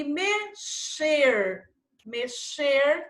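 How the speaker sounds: "mexer" slow, me-sher with a long sh. Shown as a demonstration. Teacher showing pupils the CH sound, "mexer" slowly sounded out. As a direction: sound out "mexer" in syllables, clear sh. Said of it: "tem som de C H".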